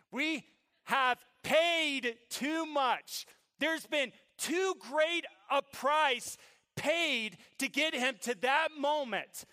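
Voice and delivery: high, loud, slow emphatic proclamation; full-force preaching. Real, not acted. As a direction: drive each phrase home to the congregation.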